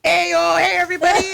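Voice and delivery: high, loud, hyped-up hello; switched-on performer greeting. Retold or imitated. Comedian switching on for a fan, loud hyped greeting. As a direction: burst into stage persona for a fan. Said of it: "like, change it".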